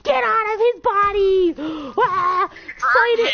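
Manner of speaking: high, loud, frantic yelling with a gasp; hammed-up desperate plea at a high pitch; mock exorcism shouting. Friends drive the demon from Will, mock frantic shouting.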